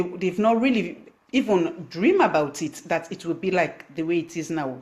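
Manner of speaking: ordinary talk, nothing marked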